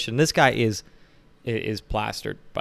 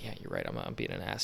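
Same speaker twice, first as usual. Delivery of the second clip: sheepish low mumble; 13 semitones lower, 14 dB quieter than usual. Retold or imitated. To Marcus, conceding in a low hushed mumble. Self mock-agreeing with Marcus.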